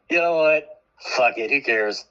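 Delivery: normal talking voice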